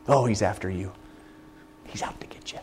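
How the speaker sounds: whispered, leaning in close; a soft conspiratorial whisper; hushed secret. Real, not acted. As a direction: tell them like a delicious secret. Preacher drops to a secret.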